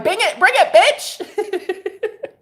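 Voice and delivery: high, laughing taunt; mock hype taunt. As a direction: dare them to come on. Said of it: "and I'm like".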